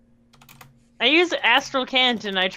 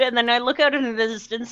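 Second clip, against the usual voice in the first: ordinary talk, nothing marked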